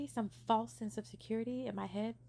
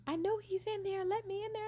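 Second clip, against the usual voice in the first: retold scream; 11 semitones higher than usual. Ex-girlfriend demanding to get into the room, mock yelling. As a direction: shout like a jealous girl banging to get in. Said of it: "somebody screaming".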